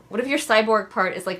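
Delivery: ordinary talk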